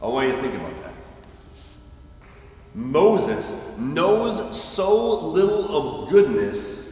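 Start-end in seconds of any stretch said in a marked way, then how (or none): 2.7-6.9 slow, so drawn out and falling; slow emphatic repeat. Acted. Preacher slowly repeating his point to listeners. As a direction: let each word land slowly.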